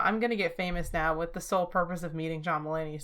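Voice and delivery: normal talking voice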